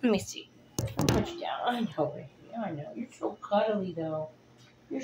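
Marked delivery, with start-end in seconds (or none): none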